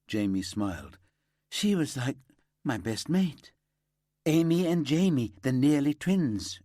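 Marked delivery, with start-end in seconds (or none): 1.5-6.6 higher, louder fond recollection; light up recalling his best mate; fond remembering. A narrator's reading. Friend fondly telling the detective about Amy, higher and louder.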